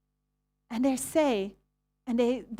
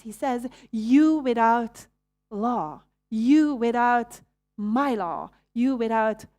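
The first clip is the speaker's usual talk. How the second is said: you without stressed, slow and louder; emphatic; 6 dB louder, slower than usual. Shown as a demonstration. Preacher explaining Jesus' words, slow and emphatic. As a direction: lean hard on without, slow. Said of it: "And when he says".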